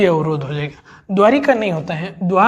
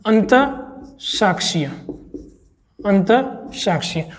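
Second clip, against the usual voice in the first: अंतःसाक्षीय said slowly with the visarga pause; slow model word; slower than usual. Shown as a demonstration. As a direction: pronounce अंतःसाक्षीय carefully for dictation. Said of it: "अंत विसर्ग संधि है".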